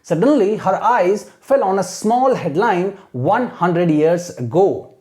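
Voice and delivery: very slow dramatic read-aloud; slow storytelling demo; slow model reading. Shown as a demonstration. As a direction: read each word slowly for students to copy. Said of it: "imitate करना है".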